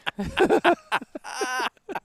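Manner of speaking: squeaky high giggle; laughing at himself, high and loud; high burst of laughter. Real, not acted. Laughs at own slip.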